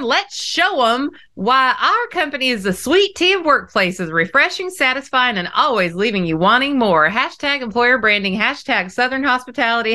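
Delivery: mock the chirpy Southern ad copy she'd never say; hammed-up Southern. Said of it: "Okay, brace yourselves".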